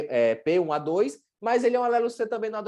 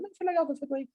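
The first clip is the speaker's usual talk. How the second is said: high, softer noncommittal answer; patient's mild reply; 10 semitones higher, 5 dB quieter than usual. Acted. Patient reports back on an undersold pre-workout, mildly content. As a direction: answer lightly, high and soft. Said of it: "Aí ele toma, chega".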